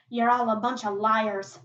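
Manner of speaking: loud, indignant charge; angry accusation. Acted. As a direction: throw the accusation out loudly. Martha's acted accusation.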